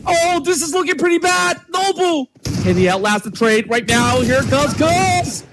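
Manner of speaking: building live play-by-play; esports caster. Acted. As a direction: call the clutch as it happens.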